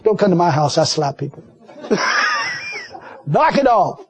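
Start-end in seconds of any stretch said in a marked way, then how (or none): 1.8-2.9 high shrieking laugh burst. Real, not acted. Laughing at the preacher's slap joke, high burst of laughter. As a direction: let out a big, high laugh.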